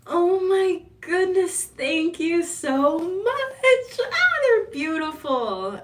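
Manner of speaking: hammed-up overjoyed thank-you; delighted gushing. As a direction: gush over the roses like a thrilled girl. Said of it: "Are you like".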